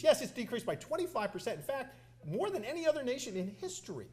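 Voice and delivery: high-pitched, insistent comeback; emphatic retort. Retold or imitated. Congressman correcting the secretary in committee, emphatic.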